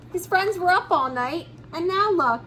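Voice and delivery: usual speaking voice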